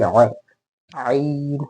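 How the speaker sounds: strangled-throat start on आ and आइन; as if throat pressed. Shown as a demonstration. Teacher telling students to feel it, आइन as if choked. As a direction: squeeze आइन out through a constricted throat. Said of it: "आपका गला किसी ने दबा दिया है".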